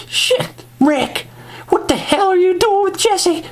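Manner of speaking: high falsetto outrage; Michonne. Acted. Woman catching Rick with Jesse, indignant falsetto. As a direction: squeal the accusation in a mock woman's voice.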